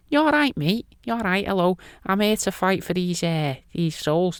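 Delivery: high-pitched comic Scouse voice; Scouse Jesus. Acted. Jesus greeting the Antichrist over souls, high Scouse voice. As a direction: put on a squeaky, soft Scouse accent. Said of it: "Don't know why he's Scouse".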